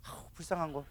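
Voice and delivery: very soft, sighing pity; scornful pity. Acted. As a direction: dismiss it quietly with pity. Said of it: "우리는 비웃어". (나직이 불쌍해하는 말투)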